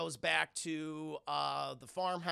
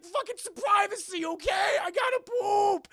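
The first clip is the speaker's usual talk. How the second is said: outraged yell; 10 dB louder than usual. Retold or imitated. Son yelling at mom from the toilet, outraged. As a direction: scream for privacy, stretching the words.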